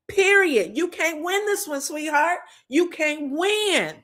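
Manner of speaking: high, sing-song gloating taunt. Retold or imitated. Recapper mocking Heavenly's smug win over Simone, high gloating. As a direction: rub it in like a smug rival.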